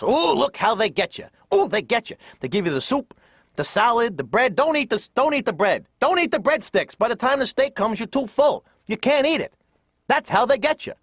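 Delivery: high, swooping warnings of a wary father; suspicious dad. Retold or imitated. Dad warning family at steak restaurant, comically suspicious. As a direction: pitch it up like dad spotting a scam. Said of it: "My dad's already suspicious".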